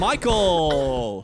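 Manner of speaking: slow, drawn-out drum-roll reveal; announcer reveal. Acted. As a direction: build suspense, then land the name. Announces the fan vote winner.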